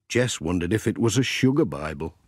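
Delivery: ordinary delivery, nothing marked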